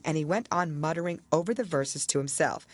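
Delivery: ordinary delivery, nothing marked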